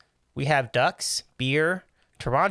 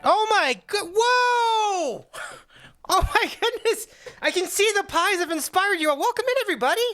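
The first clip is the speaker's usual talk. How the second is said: excited. Real, not acted. Surprised big greeting to raid.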